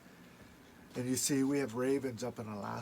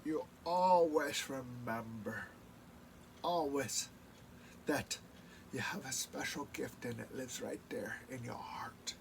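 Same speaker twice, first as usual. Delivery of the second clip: grandma's words; 8 dB quieter than usual. Retold or imitated. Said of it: "she'd go".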